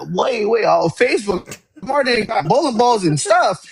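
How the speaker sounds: high-pitched, eager retold reaction; excited discovery. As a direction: re-enact being thrilled by the find. Retelling excited discovery of marketplace.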